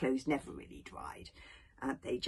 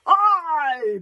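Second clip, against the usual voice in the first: loud high crowd bellow; bellowed aye; 11 semitones higher, 14 dB louder than usual. Acted. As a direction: roar it back as the whole tribe. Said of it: "bellowed out every member".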